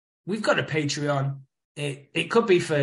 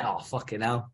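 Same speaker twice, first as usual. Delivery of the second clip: ordinary talk, nothing marked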